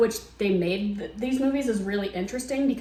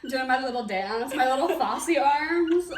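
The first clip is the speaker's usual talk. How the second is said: usual speaking voice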